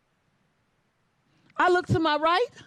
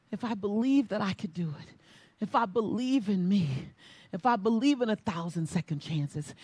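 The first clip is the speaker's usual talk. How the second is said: quiet prayer; 4 semitones lower, 5 dB quieter than usual. Acted. Praying to God before race.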